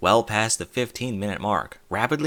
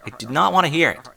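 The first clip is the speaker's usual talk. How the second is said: louder firm end to the scolding; stern finish; 5 semitones higher, 6 dB louder than usual. Acted. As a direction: close it down firmly. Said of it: "she finished".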